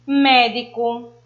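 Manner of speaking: médico slowly, MÉ drawn out and strong; slow MÉ-di-co, weight on the first syllable; stress on the first syllable. Shown as a demonstration. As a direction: lean hard on the MÉ of médico. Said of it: "o mé".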